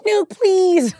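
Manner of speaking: high, hammed-up begging; mock pleading. Child begging her dad not to kick her off, mock desperate plea. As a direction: plead like a terrified kid.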